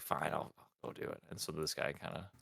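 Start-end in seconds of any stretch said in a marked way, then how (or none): 0.0-1.2 low, soft grudging agreement; reluctant guard. Acted. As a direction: give in under your breath, lightly acted. Guard gives in to order.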